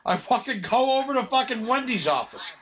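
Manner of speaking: ordinary talk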